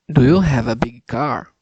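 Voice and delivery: loud, deliberate speech for the app; practicing a sentence for the pronunciation app, loud and deliberate; loud careful repeat. Shown as a demonstration. Saying it loud for the machine to catch. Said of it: "Agora sim".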